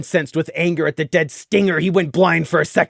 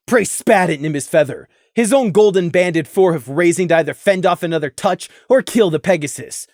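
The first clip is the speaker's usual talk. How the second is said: plain reading voice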